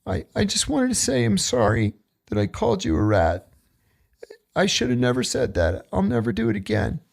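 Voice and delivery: soft, timid voice with a high cracking end; sheepish inmate, voice shrinking away; meek apology. Retold or imitated. Scared inmate apologizes to the speaker, timid and soft.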